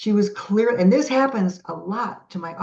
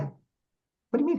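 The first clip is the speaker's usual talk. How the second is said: high, drawn-out disbelieving question; puzzled, pitch leaping up; incredulous; 9 semitones higher, 4 dB quieter, slower than usual. Retold or imitated. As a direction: question the order in retold disbelief. Questioning the voices' strange order.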